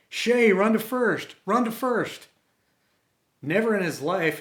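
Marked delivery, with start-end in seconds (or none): 0.1-2.3 high, loud cheering yell. Retold or imitated. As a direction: shout him on toward first base. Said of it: "Everyone started yelling".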